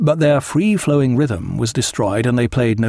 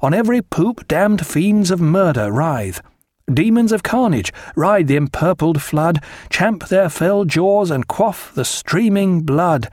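recited verse; 5 semitones higher than usual. A narrator's reading. Reading mock-heroic verse to guests, grandly. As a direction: declaim the poem with mock grandeur.

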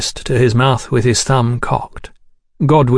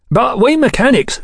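proud mechanic, pitched well up; 12 semitones higher than usual. A narrator's reading. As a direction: lift it high with pride in the trade. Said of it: "said Mister Chesterton proudly".

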